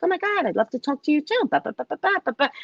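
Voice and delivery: high, fast gushing reply; mock eager gush to the guide. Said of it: "And I was like".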